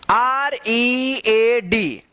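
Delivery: R E A D drawn out by letter; slow spelling. Shown as a demonstration. Teacher spells for learners, R E A D stretched slowly.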